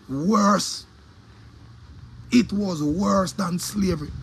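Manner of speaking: slow, weighted delivery; slow emphatic. Real, not acted. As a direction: drag it out for weight.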